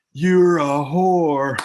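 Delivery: slow, loud drawn-out yell; mock yell. Crowd member yelling at the heel, loud and drawn out.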